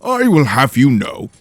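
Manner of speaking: pompous lord calmly explaining; Lord Henley, lofty. Acted. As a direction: lecture your apprentice grandly.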